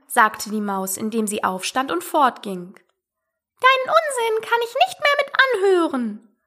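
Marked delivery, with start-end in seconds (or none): 3.6-6.4 very high indignant mouse voice; huffy mouse. A narrator's reading. Offended mouse refusing Alice as it leaves, huffily. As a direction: refuse in a squeaky huff.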